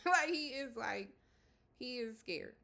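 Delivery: high, loud, laughing emphasis. Real, not acted. Amused that he is scared shitless, laughing. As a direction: laugh out loud as you insist on it.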